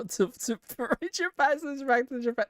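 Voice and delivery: high, giggling read that keeps cracking into laughs; can't finish the line for laughing; laughing through it. Real, not acted. Reading dialogue, breaks into laughter.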